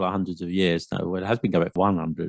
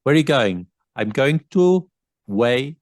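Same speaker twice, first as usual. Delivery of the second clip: higher-pitched Welsh lilt, gaps mid-word; exaggerated Welsh accent; 4 semitones higher, 5 dB louder than usual. Shown as a demonstration. Showing how you notice Welsh people, exaggerated Welsh accent. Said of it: "it's sort of like leave the gaps in the middle of words".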